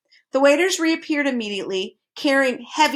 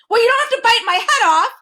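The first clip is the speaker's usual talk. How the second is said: high, loud frightened shriek; terrified scream; 13 semitones higher, 6 dB louder than usual. A narrator's reading. The terrified earl screams at the others, shrieking. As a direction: scream it in panic. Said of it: "screamed the terrified earl".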